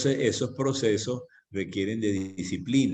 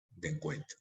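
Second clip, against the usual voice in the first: soft echoed repeat; 4 semitones lower, 10 dB quieter than usual. Shown as a demonstration. Preacher repeats de encuentro to the congregation in a hush. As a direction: drop to a hushed, lower murmur on de encuentro. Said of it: "sino está hablando de encuentro".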